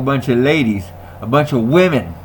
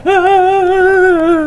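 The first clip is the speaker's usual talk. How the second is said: mock squeal; 6 dB louder than usual. Panicked squeal.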